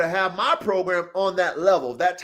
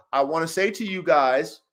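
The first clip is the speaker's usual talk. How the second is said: ordinary talk, nothing marked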